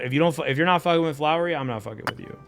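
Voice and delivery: normal talking voice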